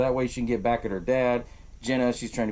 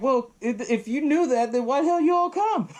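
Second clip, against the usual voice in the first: rising indignant outburst; 12 semitones higher than usual. Retold or imitated. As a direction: build from a grumble to a high squawk. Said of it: "He's like".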